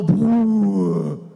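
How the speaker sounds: loud imitated thunder boom; thunder rumble. (trovão imitado)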